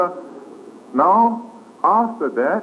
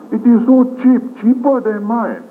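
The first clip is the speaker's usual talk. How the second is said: high, louder mock exclaim; pitched-up imitation of a gossiping friend; mock envious gossip; 10 semitones higher, 4 dB louder than usual. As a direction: play a jealous shopper crowing over a bargain. Imitates envious gossip about fashion.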